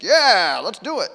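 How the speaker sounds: loud mock-cheery yeah; mock eager. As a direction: pretend to be gung-ho, ironically. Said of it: "I read this and think".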